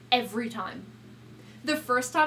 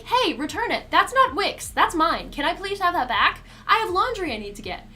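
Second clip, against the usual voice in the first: louder, higher indignant demand; mock note voice; 7 semitones higher, 6 dB louder than usual. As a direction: read the note with huffy indignation. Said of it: "put up a note to be like".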